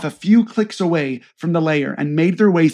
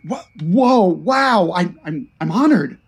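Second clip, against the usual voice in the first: awed, tripping over words; stammering. Acted. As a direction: stammer out in awe. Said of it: "Greenlee stammered".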